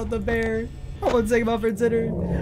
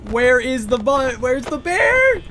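frantic yell; 4 semitones higher, 6 dB louder, slower than usual. Real, not acted. Player frantically searching for the bear.

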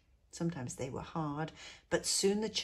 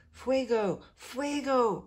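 loud, high alarm cry; shouting; 7 semitones higher, 8 dB louder, slower than usual. Acted. Neighbours waking the colonia with a loud fire alarm. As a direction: raise the alarm at full voice. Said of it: "people shouted".

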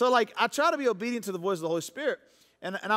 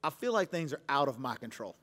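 soft retold correction; 5 semitones lower, 6 dB quieter than usual. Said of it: "And then I paused and I said".

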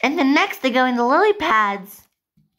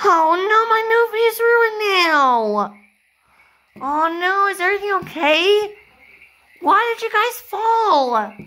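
dismayed wail; 8 semitones higher than usual. Acted. Director whose movie is ruined, dismayed wail.

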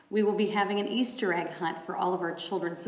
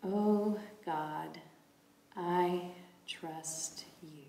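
breath prayer; 8 dB quieter, much slower than usual. Shown as a demonstration. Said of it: "breathe in and breathe out".

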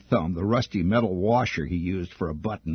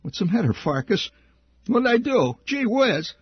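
put-on much higher, whiny kid pleading innocence; a mock-innocent whimper, loud and high; fake innocent whine; 5 dB louder than usual. A narrator's reading. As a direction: play the cowering kid, whining up high. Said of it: "pretending to be contrite".

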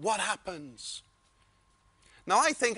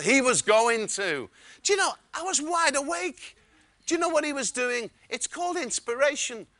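ordinary talk, nothing marked

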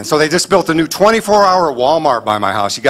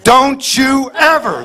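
slow, high, drawn-out mock scolding; scolding parent; 9 semitones higher, much slower than usual. Parent warning a kid, slow and high. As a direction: drag out the warning like an angry parent. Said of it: "spanked according to how long it took".

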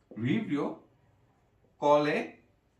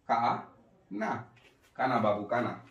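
slow dictation; 3 semitones lower, slower than usual. Shown as a demonstration. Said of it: "লিখে দিচ্ছি".

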